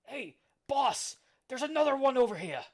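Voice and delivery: high, loud henchman yell; pirate calling out. Acted. Henchman alerting his boss to another fighter, high shout.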